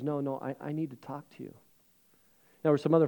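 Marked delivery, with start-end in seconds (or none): 0.0-1.5 much softer, hushed and earnest; confessing man pleading softly with the pastor; quiet insistence. Retold or imitated. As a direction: insist gently under your breath.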